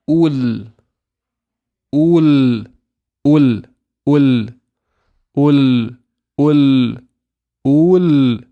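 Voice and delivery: UL loud, with the l drawn out; tongue-up lam. Shown as a demonstration. Teacher drilling lam sukoon repeatedly, loud held l. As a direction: hold UL on a clear ringing l. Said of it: "make sure your tongue goes up".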